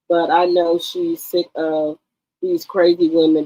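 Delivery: ordinary talk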